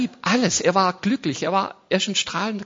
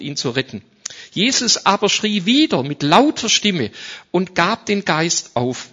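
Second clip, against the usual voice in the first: scripture reading; 3 semitones higher, 6 dB louder than usual. Acted. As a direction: read the passage with weight. Bible reading in sermon. (feierliche Schriftlesung)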